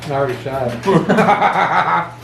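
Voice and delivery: slow words through laughter; laughing. Real, not acted. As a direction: laugh while saying it.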